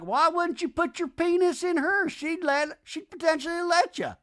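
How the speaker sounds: very high, incredulous voice; high, hammed-up prodding; mock exasperation. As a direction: spell out the obvious in a high pitch. Acts out Madison's blunt subtext.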